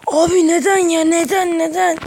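high whiny fake sob; mock crying. Gamer losing the round, mock crying. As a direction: whimper it like a sore loser.

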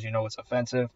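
ordinary delivery

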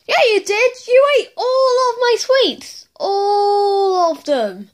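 high, loud, upset protest; hammed-up high wail of outrage; wailing accusation. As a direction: accuse them, dragging out the last words. Acted accusation over eaten sweets.

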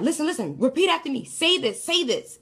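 urgent urging. Retold or imitated. Dreamer urging fading boy to pray after her, insistent. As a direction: press each repeat harder, pitch climbing.

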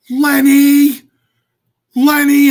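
loud, high yell of a name; calling out. Retold or imitated. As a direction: shout it across the arena. Brother yelling across the ring.

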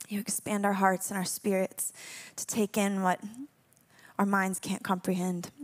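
quiet earnest prayer; soft prayer. Real, not acted. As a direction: pray softly over the room. Leads a prayer.

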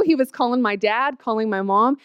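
ordinary talk, nothing marked

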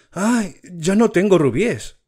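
a prince mourning his lost rubies; sad lament. Acted.

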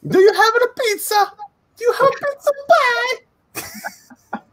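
very high, drawn-out mock Italian; squealed cartoon Italian, slowing on the end; fake Italian voice. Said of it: "fake Italian voice".

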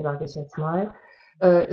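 normal talking voice

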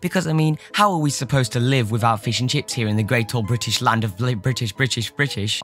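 hammed-up British accent, chatty host; mock British accent. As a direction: put on an over-the-top British accent. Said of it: "I can't do this accent".

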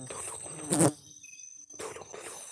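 hushed plea fading to a whisper; faint plea. Real, not acted. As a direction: beg for help under your breath. Faint plea for help after fright. (minta tolong lirih, nyaris berbisik)

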